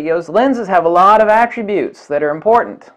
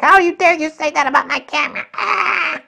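much higher, shrieking indignation; mock outraged fan; 11 semitones higher than usual. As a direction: play the offended fanboy, ending in a shriek. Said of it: "It's like".

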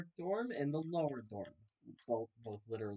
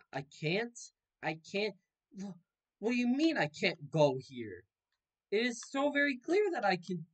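higher, louder outraged protest; indignant disbelief; 8 semitones higher, 6 dB louder than usual. Real, not acted. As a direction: act shocked you are blocked. Indignant at game blocking him.

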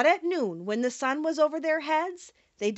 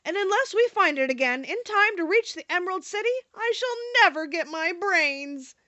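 mildly grumbling Scarecrow, pitched up; 6 semitones higher than usual. A narrator's reading. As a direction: voice a mild complaint, dragging the end. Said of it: "began to grumble a bit".

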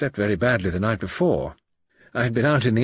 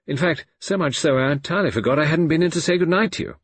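louder, higher, over-explained excuse; forced apology; 5 semitones higher, 5 dB louder than usual. A narrator's reading. Man feigning a headache to Poirot, over-apologetic. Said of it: "I forced a yawn and an apologetic smile".

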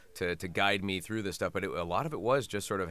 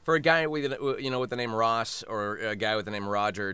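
ordinary talk

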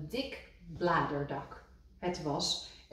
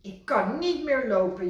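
higher, louder wail; 6 semitones higher, 6 dB louder than usual. A narrator's reading. Kikker after a fall to Rad, mild wail. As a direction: whimper in pain. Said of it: "jammerde hij".

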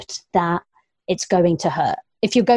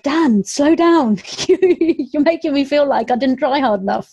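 mock call to a runner; 6 semitones higher than usual. Runner retelling calling out to Dan as he ran past, mock plea. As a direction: call after him in playful despair. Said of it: "I was like".

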